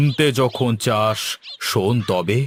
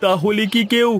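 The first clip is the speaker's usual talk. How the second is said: sarcastic jab; 9 semitones higher than usual. Acted. Grocer mocks the servant asking for a wooden doll. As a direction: needle him for his foolishness.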